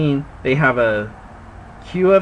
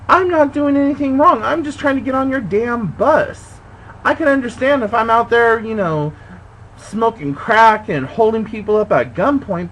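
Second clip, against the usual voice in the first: reliving the fight, shouting at the dispatcher; furious retort; 9 semitones higher than usual. Retold or imitated. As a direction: let the clerk have it, outraged. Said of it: "I'm like".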